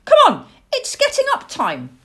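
put-on high, loud squeaky-child pestering; insistent owlet. A narrator's reading. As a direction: pester Dad loudly in a high little voice. Owlet nagging father to get up.

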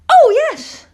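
high, loud burst of excitement; young owl thrilled by the view; delighted owlet. Acted. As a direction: exclaim it with piping wonder.

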